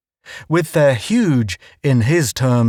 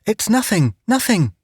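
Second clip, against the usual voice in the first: high, hurried babble; babbling; 9 semitones higher than usual. A narrator's reading. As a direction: brush it off nervously. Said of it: "Judy babbled".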